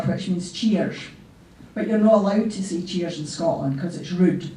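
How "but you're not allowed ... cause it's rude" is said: usual speaking voice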